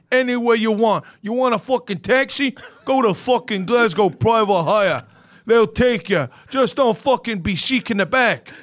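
brash New York sales pitch; New York accent. Pretend. Comic plugging the taxi app, put-on New York accent. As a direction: pitch the sponsor as a hammed-up New Yorker. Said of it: "We're from New York".

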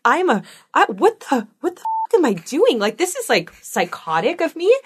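hammed-up inner freak-out; aghast inner voice. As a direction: exclaim in disbelief at yourself. Horrified inner voice at own threats.

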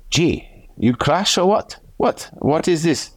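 put-on high, slow Italian-accented questioning. Rider imitating Diego after a crash, Italian accent. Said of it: "that's my Italian accent".

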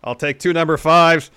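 loud, high-pitched cocky wrestler voice; L A Knight. Acted. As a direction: boom it out like a wrestler cutting a promo. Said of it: "conversation between L A Knight".

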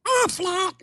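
high squawking duck call of the brand name. Retold or imitated. Replaying his duck audition in a high squawk. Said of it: "This one".

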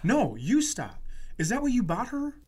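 ordinary delivery

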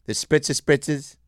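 high, chirpy imitation of a middle-aged woman; mocking friends as fifty-year-old women, high and chirpy; mock middle-aged lady. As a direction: play a gossipy mom planning a day out. Continuing the lady voice.

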